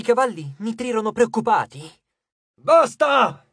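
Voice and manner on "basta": high, loud command; exasperated goddess shouting. A narrator's reading. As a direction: cry out to stop the squabble. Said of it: "gridò di nuovo Nyx".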